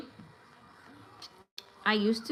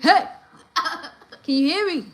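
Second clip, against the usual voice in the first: loud, higher calls, laughing at the end; calling out; 6 semitones higher, 9 dB louder, slower than usual. Retold or imitated. Deaf man loudly hailing a distant person, laughing. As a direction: shout to someone far off. Calling to someone far away.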